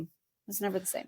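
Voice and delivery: hushed repeat, sinking into memory; quiet echo. Real, not acted. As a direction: let the line fall away softly. Repeated softly, trailing away.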